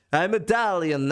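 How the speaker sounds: drunkenly put-on Italian accent; bad Italian accent. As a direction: botch an Italian accent so it drifts toward Russian. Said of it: "I went from an English accent".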